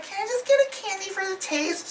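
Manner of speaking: very high put-on secretary voice; mock girly film-character voice. Reviewer mimicking the secretary asking for candy, high mock voice. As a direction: squeak it as a caricatured woman. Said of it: "Seriously, that's her voice".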